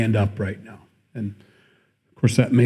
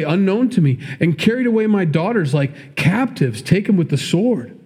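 raised, higher accusing voice; a preacher voicing Laban's outrage, higher and pressing; indignant Laban; 8 semitones higher than usual. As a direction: press him like a wronged father-in-law. Preacher reads Laban's accusing speech.

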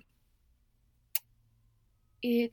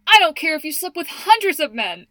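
higher and louder exclaiming; mock outburst; 6 semitones higher, 8 dB louder than usual. Sophie blurting to her mom in church, loud. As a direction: blurt it out loudly in front of everyone. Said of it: "and she goes".

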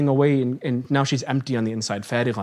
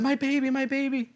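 high, soft, aching cry of a mother; mother's anguished cry; 9 semitones higher, 5 dB quieter than usual. Retold or imitated. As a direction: softly cry out for the lost baby. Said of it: "she almost turned around and said".

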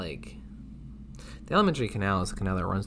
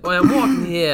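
New Yorker; 8 semitones higher, 10 dB louder than usual. Retold or imitated. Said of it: "The guy's like".

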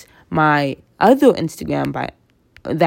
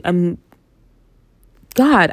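ordinary talk, nothing marked

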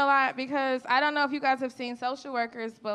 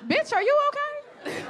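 much higher, slow, laughing disbelief; incredulous sass; 13 semitones higher than usual. Real, not acted. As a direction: ask it high and slow, half laughing. Mocking the fake happy people.

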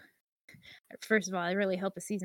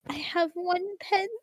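high, slow pleading urchin; whimpering poor orphan; Victorian boy voice; 10 semitones higher, 4 dB louder than usual. Pretend. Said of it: "Victorian boy".

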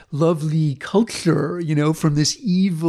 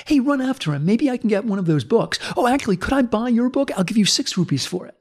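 higher-pitched mock eagerness; lightly excited, raised-pitch quote; eager villager; 7 semitones higher than usual. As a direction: act out a keen bystander calling after someone.

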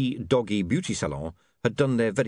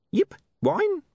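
yipping dog. A narrator's reading. Dog prods William to follow.

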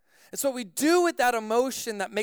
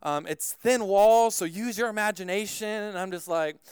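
ordinary talk, nothing marked

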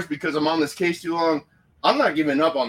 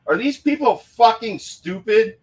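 exasperated outburst; 8 semitones higher, 3 dB louder than usual. Real, not acted. Angry at the police ruling.

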